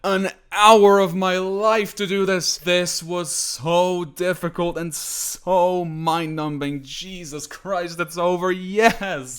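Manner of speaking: loud, high, slow victory shout; ecstatic shouting. Real, not acted. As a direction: roar with relief and joy. Finally beats the level.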